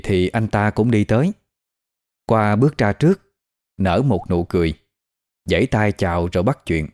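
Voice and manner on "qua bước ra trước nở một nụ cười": plain reading voice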